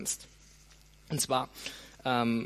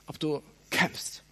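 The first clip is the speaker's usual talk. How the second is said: ordinary talk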